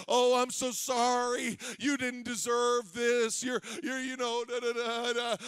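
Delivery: a hint of a sweet-talking lullaby; mock soothing lullaby. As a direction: lull them back to sleep. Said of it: "just listen to me sing".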